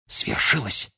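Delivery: excited stage whisper; breath-only conspiratorial announcement. A narrator's reading. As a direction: whisper the triumphant news. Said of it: "шепнули они брату и его друзьям".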